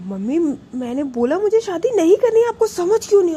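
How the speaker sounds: high, loud, exasperated refusal. Retold or imitated. Prachi shouts at her mother that she won't marry, high and loud. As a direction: finally shout back at mother. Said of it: "थोड़ा सा तेज आवाज़ में बोल जाती है".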